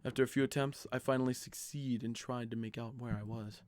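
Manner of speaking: quiet, even reading of game text; story narration. A narrator's reading. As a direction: read the story calmly to the audience. Reads the game's story text.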